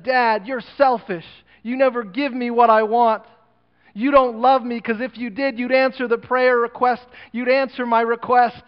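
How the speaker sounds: high, loud accusing shout; a son yelling that his father is selfish; angry son. Acted. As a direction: throw the blame at him in a rage.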